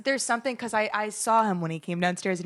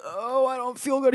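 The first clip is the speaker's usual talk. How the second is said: higher, mock-pitiful moan; whining sick dad; 4 semitones higher, 3 dB quieter than usual. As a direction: imitate a self-pitying patient. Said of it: "it's a theater act".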